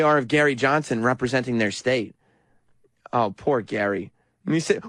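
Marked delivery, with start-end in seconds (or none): none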